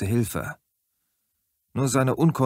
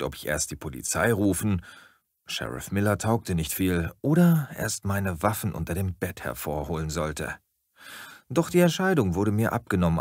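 ordinary delivery, nothing marked

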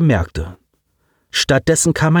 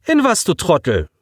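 higher sharp snap; snapping; 8 semitones higher than usual. A narrator's reading. Girl snaps at Alex, sharp and higher. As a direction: cut him down. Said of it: "fuhr sie ihn an".

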